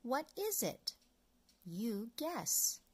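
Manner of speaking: plain reading voice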